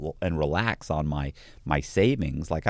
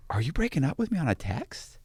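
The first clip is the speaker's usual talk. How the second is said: voiced, rising, higher disbelieving question; incredulous question; 6 semitones higher, 4 dB quieter than usual. Repeating his question to girlfriend, voiced and incredulous. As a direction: ask it aloud, pitched up in disbelief. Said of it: "and I went".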